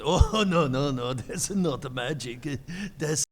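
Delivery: laughing, loud imitation of a Japanese accent. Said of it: "I'm going to do an accent".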